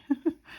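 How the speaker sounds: giggle. Real, not acted. Laugh after pity.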